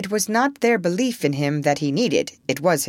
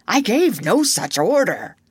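unsettled denial, voice somewhat raised; shaking a little; 3 semitones higher, 4 dB louder than usual. A narrator's reading. Hook denies the order to his lads, slightly shaken. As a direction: deny it with a touch of unease. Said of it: "shaking a little".

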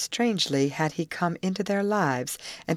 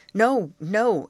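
higher, louder quick objection; urgent interruption; 5 semitones higher, 5 dB louder than usual. A narrator's reading. The youth interrupts Betty's offer, urgent objection. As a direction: cut in firmly to stop the offer. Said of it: "interrupted the youth".

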